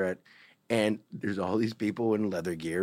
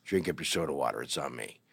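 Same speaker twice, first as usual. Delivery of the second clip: huge gruff bartender; 7 semitones lower, 4 dB quieter than usual. Retold or imitated. Huge bartender warning a straight boy off, low condescending voice. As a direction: talk down to the kid like a big bouncer. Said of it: "he's like".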